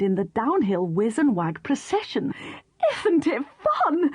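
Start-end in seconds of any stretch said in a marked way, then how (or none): 3.0-4.1 high, slow call. Acted. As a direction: call out with delight. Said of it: "Katy called".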